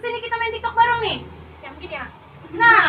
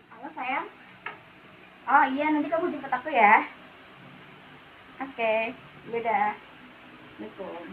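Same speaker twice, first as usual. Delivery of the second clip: sweet phone voice; 7 semitones lower, 7 dB quieter than usual. Acted. Sweet phone call to boyfriend.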